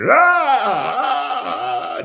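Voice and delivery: put-on a high, loud, sudden child's wail; child's scream. Little girl screaming after her shot, a high loud wail. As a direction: scream out like a kid just stuck with a needle. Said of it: "and just hollering".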